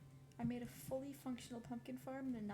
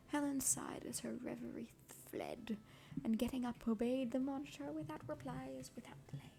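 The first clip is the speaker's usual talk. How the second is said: whispered reading; 3 semitones lower, 7 dB louder than usual. Real, not acted. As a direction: whisper the passage gently, like ASMR. Whispered reading of Jane Eyre.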